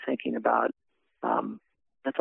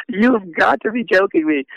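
retold disbelief; 9 semitones higher, 5 dB louder, slower than usual. Retold disbelief at last hope.

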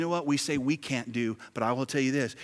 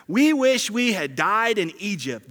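loud wailing complaint; 8 semitones higher, 10 dB louder than usual. Retold or imitated. Preacher reading Israelites' complaint, loud wailing. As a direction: wail it like a grumbling crowd. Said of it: "they wailed".

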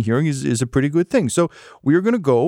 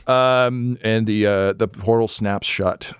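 normal talking voice

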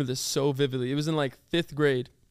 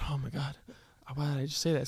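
much softer, retold private regret; hushed dismay; 8 dB quieter, faster than usual. Student realizing what was said to teacher, hushed dismay. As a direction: imitate a hushed, mortified aside to oneself.

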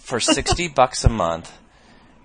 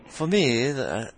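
attempted English accent; 4 semitones higher, slower than usual. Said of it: "Can you read it with the accent?".

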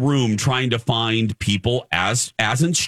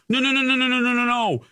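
high, rattled-off string of refusals; mock panic, stammering out each no; panicked refusal; 8 semitones higher than usual. Retold panic at the line.

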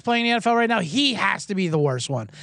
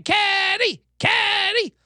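high shouted cheer of a casino crowd; 11 semitones higher, much slower than usual. Retold or imitated. Crowd at the table cheering Kenny, shouted. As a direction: yell his name like the cheering crowd. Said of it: "They're chanting".

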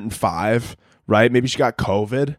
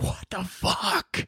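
stunned hush; 6 semitones higher, 9 dB quieter, much slower than usual. Retold or imitated. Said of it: "you're like".

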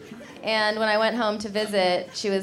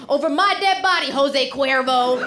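angry dad; 3 dB louder than usual. Retold or imitated. Dad blasting a suitor on Facebook, mock furious. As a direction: ham up a protective father's outburst. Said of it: "And my dad's like".

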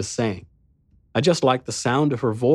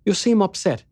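1 sweet concern; 9 semitones higher than usual. Acted. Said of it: "she said with sweet concern".

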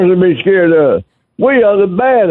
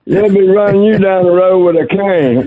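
usual speaking voice

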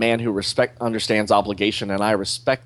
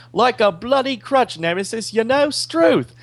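hammed-up Australian accent, much higher; bad Australian accent; 13 semitones higher than usual. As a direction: play a cocky Aussie convict in a clumsy Australian accent. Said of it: "You sound more like Spike from Buffy".

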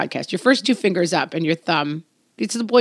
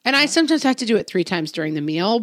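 ordinary talk

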